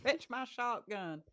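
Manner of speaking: high, slow Southern drawl; mock backwoods Southern twang; Southern accent. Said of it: "those are easier to mimic".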